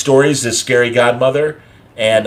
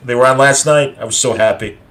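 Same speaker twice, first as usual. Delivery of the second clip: normal talking voice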